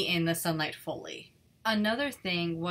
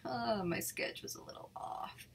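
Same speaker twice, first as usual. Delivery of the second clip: whispered aside to herself; self-quote whisper; 2 semitones higher, 11 dB quieter than usual. Retold or imitated. Artist quoting her own thought as a whisper. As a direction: hush it like a little confession. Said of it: "I'm like".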